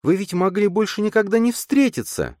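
резко, высоко, с упрёком; обвиняй отца, повысив голос; обвиняющий тон. A narrator's reading. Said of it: "обвиняющим тоном". (обвиняющим тоном)

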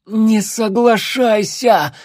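strained, high-pitched croaking plea; choked croak. A narrator's reading. Captive girl held by the throat warns her friend, choked croak. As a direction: force it out through a squeezed throat, high and drawn out. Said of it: "прохрипела Эрминия".

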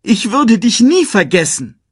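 high, loud anguished cry; upset, near sobbing. A narrator's reading. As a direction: cry out in distressed protest. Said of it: "Ein Schluchzen stieg in seiner Kehle auf". (verzweifelt und hoch ausrufen)

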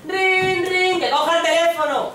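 loud, high ringing yell; high insistent acted shout; shouted phone ring. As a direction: ring like a phone demanding an answer. Phone rings, order to answer.

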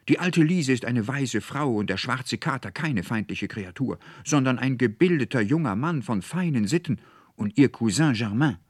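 high, loud indignant defence; angry cry. A narrator's reading. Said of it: "rief Veronika mit zornglühenden Augen".

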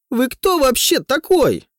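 high, loud furious shriek; sudden shriek. A narrator's reading. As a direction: snap into a shriek. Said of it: "Резко срывается на крик".

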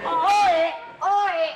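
high, loud "Oe" shouted out; loud call. Acted. Calling out to start the pantun exchange, loud.

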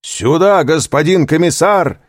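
громкий высокий командный окрик; крикни, подзывая начальника. A narrator's reading. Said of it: "крикнул унтер-офицер". (громкий окрик)

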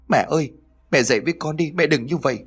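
high, fast grieving plea; bursting into tears. A narrator's reading. Son begging his dead mother to wake, tearful. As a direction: cry out to her in rising grief. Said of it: "anh bật khóc".

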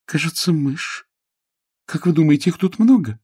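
put-on high young lady's voice; uneasy Jane. Jane suspects mice in the cupboard, uneasily. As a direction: ask nervously about mice.

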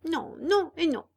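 high, firm no; stubborn refusal. A narrator's reading. Pinocchio flatly refusing Candlewick, stubborn. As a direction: dig in your heels.

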